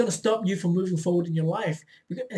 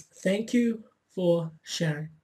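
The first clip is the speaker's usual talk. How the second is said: slowly spelling out "thank you for sharing"; slow emphatic; slower than usual. Shown as a demonstration. Coach repeating the magic words slowly for viewers. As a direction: draw out each word of "thank you for sharing". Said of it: "again, it's".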